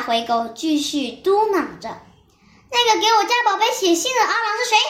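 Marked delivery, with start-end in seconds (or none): 2.7-4.8 higher and fast interrogation; demanding question. A narrator's reading. Mother sheep grilling the post-office dog, sharp demand. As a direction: pin the postman down with a quick, pointed question. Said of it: "羊妈妈质问道".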